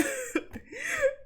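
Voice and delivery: very high squealing laugh; high squeaky laugh. Real, not acted. Laugh at soju joke.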